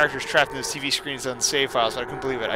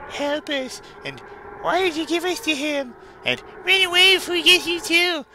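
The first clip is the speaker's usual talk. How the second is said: ghostly pleas. Acted. Voices Tails and Knuckles crying.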